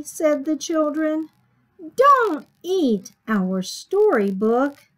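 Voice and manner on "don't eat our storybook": slow, loud, emphatic complaint; drawn-out scolding. Acted. Children tell Sammy off over storybook, scolding slowly. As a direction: hit each word of the telling-off.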